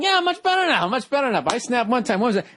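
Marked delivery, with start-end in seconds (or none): 0.0-1.4 higher, laughing mockery of a friend's claimed calm; mock calm recovered friend. Host teasing his friend, laughing mock voice of the calmer man. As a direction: laugh while parodying his breezy denial. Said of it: "You're doing this whole thing".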